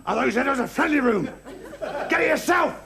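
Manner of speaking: high, protesting shout; angry retort. Acted. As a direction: yell back defiantly. Shouting back in acted quarrel.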